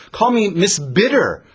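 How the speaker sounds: sharp, bitter. Acted. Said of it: "[name] is a little sharp".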